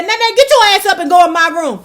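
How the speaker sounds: put-on a grandmother ordering the kid out of bed; stern grandma order. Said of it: "My grandmother said".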